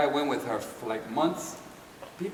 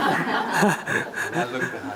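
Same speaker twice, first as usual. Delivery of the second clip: usual speaking voice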